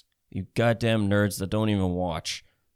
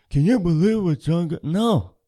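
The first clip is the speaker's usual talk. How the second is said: lightly mocking voice, a bit higher; mocking shocked people; 5 semitones higher than usual. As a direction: parody scandalised fans, half-hearted.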